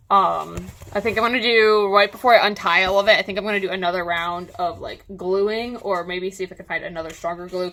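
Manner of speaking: usual speaking voice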